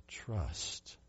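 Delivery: near-silent trust; whispered word. Real, not acted. The sermon builds to a climax.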